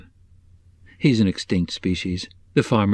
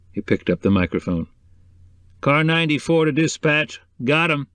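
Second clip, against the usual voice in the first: lightly voiced laconic deputy, a little higher; clipped country lawman, lightly done; 4 semitones higher than usual. A narrator's reading.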